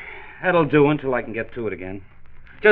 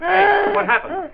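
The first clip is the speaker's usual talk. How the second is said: much higher, loud alarm; startled shout; 6 dB louder, slower than usual. Acted. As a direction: call out at the sudden crash. Father hears the crash.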